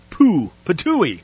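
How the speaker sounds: spat-out "ptooey"; mock spitting. Teacher acting out "expel", mock spitting. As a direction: imitate spitting something out.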